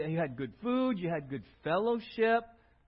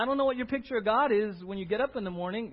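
ordinary talk

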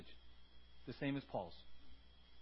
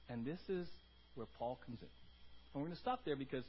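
ordinary talk, nothing marked

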